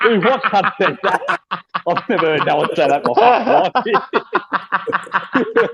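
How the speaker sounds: words squeezed out through laughter; laughing disbelief. Real, not acted. Mocking someone who said crikey, laughing hard. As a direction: laugh through it, can't believe it.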